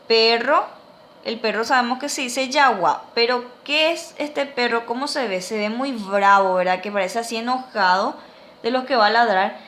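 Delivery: ordinary talk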